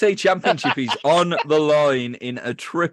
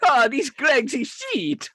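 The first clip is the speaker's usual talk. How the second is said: hammed-up high, slow complaint; 10 semitones higher than usual. Joking about a bakery chain, hammed-up voice. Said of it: "Stereotypical voices".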